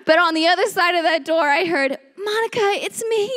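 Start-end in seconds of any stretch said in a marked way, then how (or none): 2.1-3.3 put-on high, small child voice; little brother's voice. Little brother on the other side of the door, high small voice. Voicing her ten-year-old little brother.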